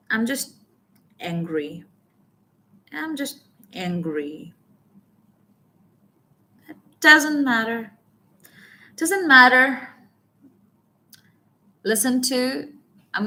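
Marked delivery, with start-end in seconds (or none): 0.1-4.5 pause, then angry set apart and slow; stress on angry. Shown as a demonstration. Coach demonstrates a key word for learners, stress on angry. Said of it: "I have stressed on the word anger here". 6.7-9.9 louder and higher, leaning hard on matter; stress on matter. Shown as a demonstration. Coach demonstrates a key word for learners, stress on matter. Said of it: "Close your eyes and listen to this".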